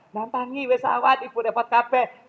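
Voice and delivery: omelan ibu yang tinggi dan keras; ibu mengomel. Retold or imitated. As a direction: tiru ibu yang cerewet. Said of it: "jangan banyak-banyak kalimatnya". (ibu mengomeli anak yang belum bangun, nada tinggi)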